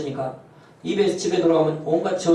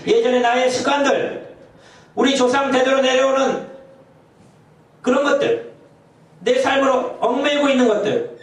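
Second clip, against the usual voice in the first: higher, insistent pulpit exhortation; preaching at full force; 7 semitones higher than usual. Acted. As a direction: call the church to change, rising. Preacher exhorts at raised pitch.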